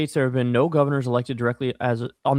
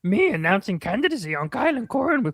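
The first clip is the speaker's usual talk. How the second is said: high puppet monster voice; Cookie Monster voice; 9 semitones higher than usual. Pretend. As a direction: announce it like a cookie-crazed monster. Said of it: "Read in me voice".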